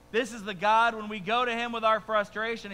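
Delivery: ordinary talk, nothing marked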